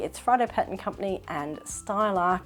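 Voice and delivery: ordinary talk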